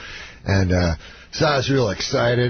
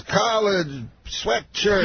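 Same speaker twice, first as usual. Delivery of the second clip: very slow, higher mimicry, word by word; Bill Cosby; 8 semitones higher, much slower than usual. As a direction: drawl two lone words as an impression. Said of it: "He talks, like, just in words".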